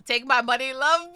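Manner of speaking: loud, high, laughing exclamation; hammed-up excited squawk; mock outburst. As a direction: call it out high and overexcited. Playful outburst at another donation.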